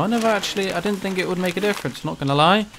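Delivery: ordinary delivery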